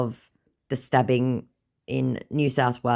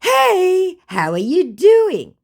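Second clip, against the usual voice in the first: high, bright mock greeting from the scammer; scammer's greeting; 8 dB louder, much slower than usual. Imitating the scammer greeting her, high and loud. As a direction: put on the scammer's sing-song hello. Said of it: "said my scammer".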